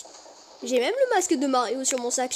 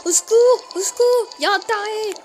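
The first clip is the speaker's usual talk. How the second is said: appel au secours; 7 semitones higher, 7 dB louder than usual. Pretend. Fuit le marchand en criant.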